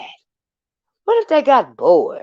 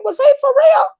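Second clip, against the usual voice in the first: incredulous squeal. Retold or imitated. Griping to viewers about a gym-going man, mock-incredulous squeal. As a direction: shriek it like you can't believe your ears. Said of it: "I'm thinking".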